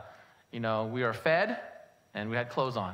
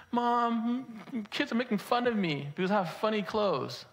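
lightly voiced whining kid, raised at first; a mild imitation of a child complaining to mom; hurt child; 7 semitones higher than usual. Upset boy telling mom about teasing, mildly whiny.

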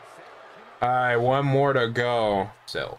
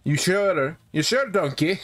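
higher, lilting ogre impression; Shrek; 5 semitones higher than usual. Gamer as gang leader doubting the challenger, mock Shrek voice. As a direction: question it like a cartoon ogre. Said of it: "I'm not trying to do a Shrek voice".

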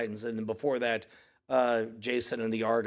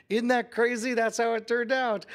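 excited dad; 9 semitones higher, 3 dB louder than usual. Retold or imitated. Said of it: "And I'm like".

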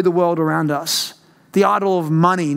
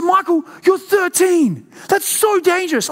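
mum shouting; 10 semitones higher than usual. Retold or imitated. Mum scolding her thirteen-year-old son at three AM, high shout. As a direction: scold him like a frightened mother. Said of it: "she said".

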